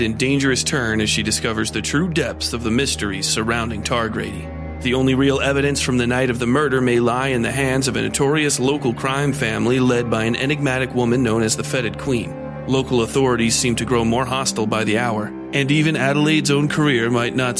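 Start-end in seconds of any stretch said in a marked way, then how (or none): none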